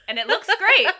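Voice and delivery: high, loud laughing doctor bit. Pretend. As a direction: laugh through it.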